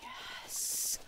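quiet, gleeful whispered yes. Retold or imitated. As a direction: whisper it like a secret victory. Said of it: "And I was like".

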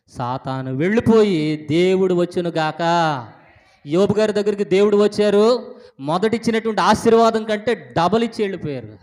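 loud, high, triumphant sermon delivery; full-force preaching. Real, not acted. Preacher telling the congregation of Job's double blessing, full force. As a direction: proclaim it at full voice.

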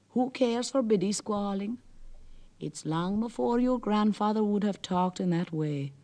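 mildly mocking old Merrow bragging; lightly voiced scoffing Merrow. Merrow teases Jack about his grandfather, lightly scornful.

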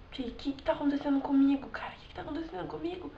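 retold private thought, quiet and puzzled; bewildered self-talk. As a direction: murmur it to yourself, baffled. Retells bewildered self-talk at sink.

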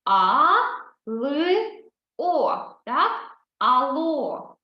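"А, Л, О" each sound named separately, then "Ало"; name each sound, then join them. Shown as a demonstration. Teacher sounds out "Ало" for the class, sound by sound. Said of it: "Читаємо по звуках, називаємо звуки".

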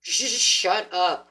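slow, drawn-out, loud shut up; intuition snapping. Pretend. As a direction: drag it out like a fed-up inner voice. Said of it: "your intuition's over here like".

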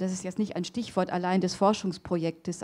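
usual speaking voice

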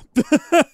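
loud high-pitched laugh; laughing out loud, high and bright; a real loud laugh at the controller mishap; burst of laughter. Real, not acted.

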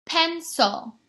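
"Pencil" in two beats, PEN high, SIL falling; split into syllables. Shown as a demonstration. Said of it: "use my chin to start".

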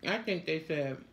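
talking between bites; chewing while talking. Real, not acted. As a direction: mouth full of food. Seen 3: Woman in red chews with closed, puffed mouth while gesturing.

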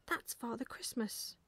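usual speaking voice

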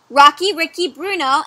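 ordinary delivery, nothing marked

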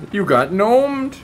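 high, loud, slow taunt; slow high gloating taunt; mock taunt. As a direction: gloat slowly at them. Taunting victims.

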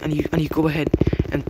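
ordinary delivery

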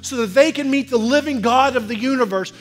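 loud, high, driving preacher's call; full-force preaching. Acted. Pastor rousing the congregation to reach outsiders, loud full-force preaching. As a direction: preach it hard to the whole room.

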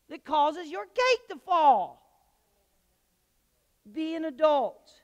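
loud, rising, slow preaching climax; fervent preaching. Real, not acted. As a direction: lift the church to its feet. Preaching builds.

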